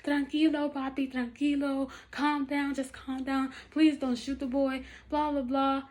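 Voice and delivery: pleading. Retold or imitated. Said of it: "I'm like".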